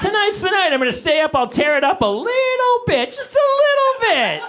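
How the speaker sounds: sing-song like you're about to party; giddy excitement. Acted. Parent planning a late night, giddy and sing-song.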